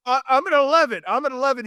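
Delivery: high-pitched, worked-up exclamation; at an eleven. Real, not acted. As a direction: crank it up to eleven. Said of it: "you're at an eleven that day".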